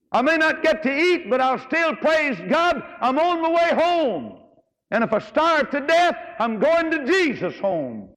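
high, slow, exultant preaching cry; soaring high praise, stretched out slowly; fervent prophet voice. Acted. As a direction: lift it up like a rejoicing prophet. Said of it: "That's what he said".

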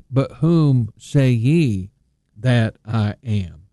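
slow, weighty whom say ye; slow, solemn quoting of Jesus; stress on whom. Shown as a demonstration. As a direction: lean into whom and let it hang. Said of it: "the word you is emphatic".

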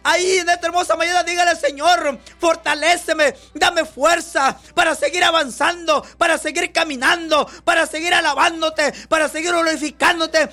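predicador clamando a Dios con fervor; oración predicada. Acted. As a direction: ruega con fuerza como en oración. Fervent prayer preaching. (ora a voz en cuello ante la congregación)

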